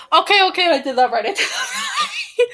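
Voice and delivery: flustered, breaking into high laughter; laughing relief. Real, not acted. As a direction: let the words dissolve into laughing. Laughing at own frustrated counting.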